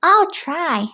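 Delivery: put-on a willing try from young Pete; lightly voiced boy cat; Pete agreeing. A narrator's reading.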